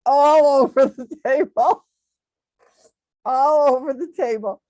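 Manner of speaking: loud, high laughing exclaim; amused exclamation. Real, not acted. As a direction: burst out at the mess. Laughing at her own spill.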